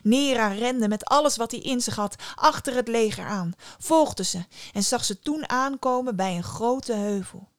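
ordinary delivery

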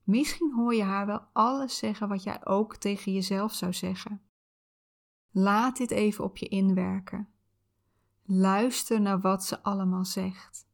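calm soothing visualization guide; soft spa whisper. Acted.

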